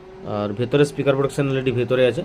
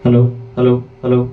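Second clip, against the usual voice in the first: mic test; 5 dB louder, faster than usual. Shown as a demonstration. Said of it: "দেখো".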